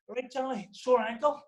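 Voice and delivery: high, laughing put-on voice for a fake excuse; mock innocent excuse. Elvis covering for him in front of George, high and laughing. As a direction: sell the lie with a grin in the voice.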